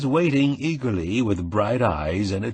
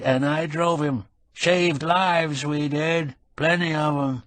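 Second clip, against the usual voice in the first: put-on high, slow rural old man, fond memories; a frail old caretaker reminiscing slowly; old farmhand; 6 semitones higher than usual. A narrator's reading. Narrator voices old Hornbuckle.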